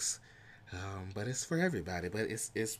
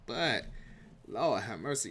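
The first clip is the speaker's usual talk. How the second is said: ordinary talk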